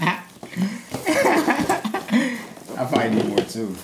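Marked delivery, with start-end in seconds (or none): 0.3-2.7 squeaky high helium laugh. Real, not acted. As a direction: giggle on a lungful of helium. Laughing after breathing in helium.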